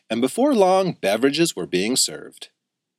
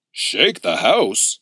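echoing shop owner; much slower than usual. A narrator's reading. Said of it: "echoed the shop owner".

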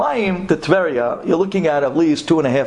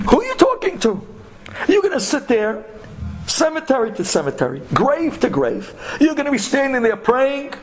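high-pitched incredulous berating; retold cabbie scolding in a raised pitch; 8 semitones higher than usual. Taxi driver tells the scholar his prayers are pointless, incredulous scolding.

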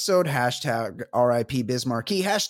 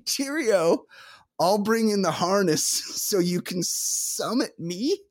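higher-pitched hammed-up British voice; cartoonish British lover, pitched up; revolting British accent; 7 semitones higher than usual. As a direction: put on a silly high British accent. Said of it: "Revolting British accent".

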